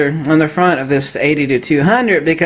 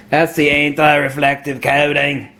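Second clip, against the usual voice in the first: lower, broad mock Aussie; Australian accent; 4 semitones lower than usual. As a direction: slip into a put-on Australian accent. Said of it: "my use of an Australian accent".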